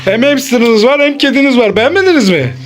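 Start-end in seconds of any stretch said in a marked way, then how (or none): none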